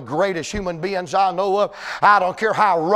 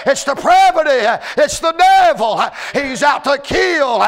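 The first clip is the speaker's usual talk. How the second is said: loud, higher preacher's shout, slowing down; full-force preaching; 6 semitones higher, 4 dB louder, slower than usual. Acted. Preacher warning the young people in the room, loud and higher. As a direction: warn them with everything you've got.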